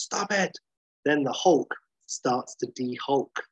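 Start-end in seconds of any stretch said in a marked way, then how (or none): 0.0-0.6 high-pitched cry, no real volume; retold shout, pitched up in protest; mock shout. McGee stares in horror as Hulk is zapped, mock shout. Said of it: "He shouts".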